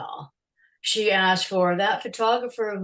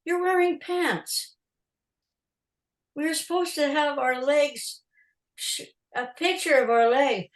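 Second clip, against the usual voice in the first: mock dismay; 7 semitones higher than usual. Photographer objecting to the star's pants, mock dismay. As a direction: protest in playful disbelief, pitched up.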